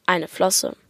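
plain reading voice

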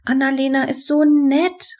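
high-pitched eager exclamation; enthusiastic call. A narrator's reading. Henrietta suggests Annalena to Benny, calling out eagerly. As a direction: call it out keenly, pitched well up. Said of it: "rief sie".

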